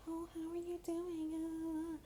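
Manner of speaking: high, eerie singsong greeting; creepy sweet voice. Retold or imitated. Mocking the creepy caller in a high fake-friendly voice.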